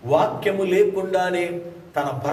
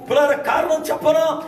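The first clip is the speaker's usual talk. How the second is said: preaching at full force; 10 semitones higher than usual. Acted. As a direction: proclaim it to the whole congregation.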